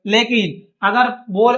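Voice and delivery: ordinary delivery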